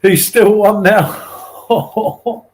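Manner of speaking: drawn out and broken by laughter; laughing. Real, not acted. Teasing about the son still being one, laughing. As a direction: laugh through the punchline. Joking about the son's age.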